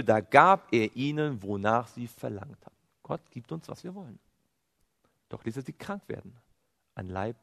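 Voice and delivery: usual speaking voice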